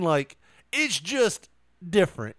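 ordinary talk, nothing marked

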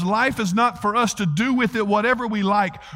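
usual speaking voice

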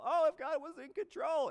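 high-pitched doubter's protest; mock objection. Preacher voices a doubter questioning God, in high-pitched mock protest. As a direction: pitch it up as a sceptic's complaint.

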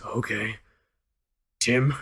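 halting reluctant. Retold or imitated. Coworker forced, halting.

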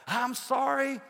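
calling out sorry again; high called-out apology. Retold or imitated. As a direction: call sorry from a distance. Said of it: "I hear somebody".

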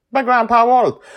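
high, loud drawn credit line; announcer outro. Acted. Host closing the episode, high loud announcer sign-off. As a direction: sign off with flair.

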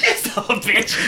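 high, helpless laughter through the words; laughing. Real, not acted. Host to co-host who waited two seconds, laughing. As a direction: scold him while cracking up.

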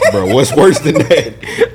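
laughing while asking; chuckling through the question. Real, not acted. Laughing disbelief at story.

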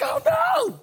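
high, loud panicked yelp; a chicken-hawk squealing in panic; scared cry. Acted. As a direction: squeal it in sudden fright. Herbie sees the hawk land.

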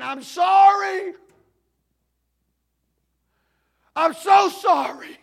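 high, loud, slow anguished scream; screaming apology. Retold or imitated. Broken pastor at the pulpit, screaming his apology. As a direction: wail it out in sorrow. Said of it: "starts screaming".

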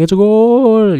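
sudden high, loud "It's a goal" in a put-on voice; mock commentator goal call. Mocking the British commentator's calm goal call, excited. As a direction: belt it like a TV commentator calling a goal. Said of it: "one guy just, like, comments on the ball".

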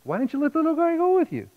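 hammed-up mom laying into them; scolding mom. Mother scolds son and dad for leaving the little one, high mock scolding. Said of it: "she laid into both of us, like".

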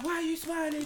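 high-pitched mocking imitation of a teacher. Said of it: "she was like".

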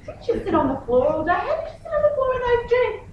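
high, quick questioning; acted mum grilling her daughter, pitch climbing; hammed-up disbelief, fast and high; mock incredulity.

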